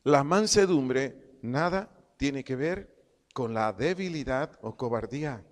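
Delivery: measured phrases with pauses between. Shown as a demonstration. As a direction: dictate "La mansedumbre" piece by piece. Repeats definition slowly for note-takers.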